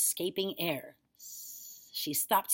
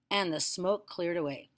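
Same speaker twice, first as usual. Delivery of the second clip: usual speaking voice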